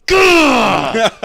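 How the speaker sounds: mock gag sound. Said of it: "she was like".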